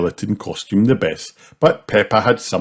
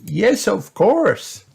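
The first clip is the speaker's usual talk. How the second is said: Granny voice; 13 semitones higher, much slower than usual. A narrator's reading. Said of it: "replied Granny Pig".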